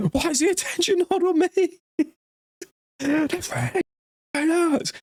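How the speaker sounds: shrill very high self-pitying whine; whiny old man wail. Retold or imitated. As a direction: squeal it like a spoilt old man. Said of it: "he's the one that's screaming the most".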